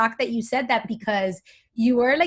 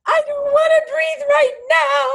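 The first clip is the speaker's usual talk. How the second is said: high, loud tantrum whine; imitating mad kid; 6 dB louder than usual. Mother mimicking her kids mid-tantrum, crying angry protest. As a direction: wail like an upset child, pitched way up. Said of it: "they get more mad. They go".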